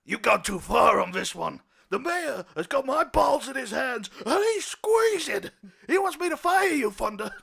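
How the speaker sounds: loud, high stressed captain ranting; exasperated captain. Acted. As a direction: rant at the cop who costs you. Captain yells at cop.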